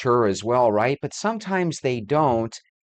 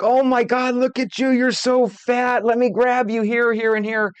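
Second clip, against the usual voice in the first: mock gushing old friend; 10 semitones higher, 3 dB louder than usual. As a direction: play a pushy old friend grabbing at her. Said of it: "people that are your old friends do things like".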